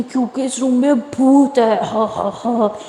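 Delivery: slow, eerie mock-ghost voice with an evil laugh; hammed-up creepy ghost voice; spooky ghost voice. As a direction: tease her like a ghost in a horror film. Sister spooks Lily about ghost.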